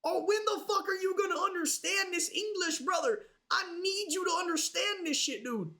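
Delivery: high mock yell at the dog; frustrated owner yelling at a dog who won't learn; exasperated yell. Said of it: "I try and talk slow".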